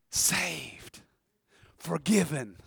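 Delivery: low, soft single words; sudden quiet after the climax; hushed awe. Real, not acted. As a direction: breathe each word out reverently. Awed list after a shouted climax.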